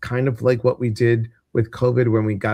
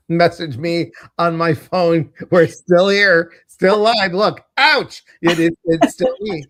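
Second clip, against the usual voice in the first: calling out; 7 semitones higher, 6 dB louder than usual. Real, not acted. Calling out to viewers on stream.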